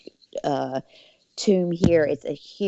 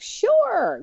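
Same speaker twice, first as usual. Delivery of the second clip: eager yes. Retold or imitated. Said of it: "And we're like".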